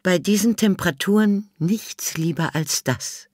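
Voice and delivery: high, put-on elderly lady, dry; old lady Miss Sophie. A narrator's reading. Old Miss Sophie answers the inspector, high and dry. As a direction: play the haughty old lady.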